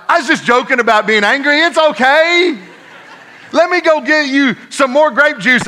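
high, over-eager mock reassurance; buttering her up. Father placating his upset daughter, hammed-up sweet-talk. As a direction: placate her hastily, pitch jumping up. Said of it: "I'm trying to butter her up".

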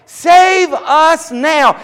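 high, loud, slow chanted cry; shout in unison. Retold or imitated. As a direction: cry out like a choir of worshippers. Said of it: "shout it together in unison like a choir".

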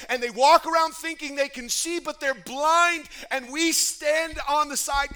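loud, urgent, fast-building sermon voice; full-force preaching. Acted. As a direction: preach it hard to the church.

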